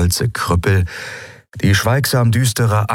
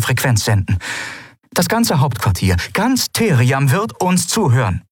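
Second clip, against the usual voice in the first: much higher, firm admiral laying down the law; 7 semitones higher than usual. A narrator's reading. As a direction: state it with authority. (bestimmte Admiralin)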